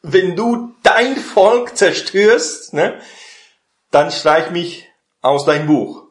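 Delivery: high, loud, slow defiant retort; hitting back. Retold or imitated. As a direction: throw the words back at God. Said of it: "Er hat zurückgeschlagen". (Mose schlägt laut und trotzig zurück)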